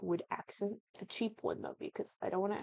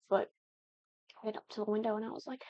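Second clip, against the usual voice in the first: normal talking voice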